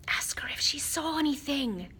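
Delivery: whispered; mouthed. A narrator's reading. Harry mouths a question to Hermione, whispered. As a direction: breathe it without voice. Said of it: "Harry mouthed at Hermione".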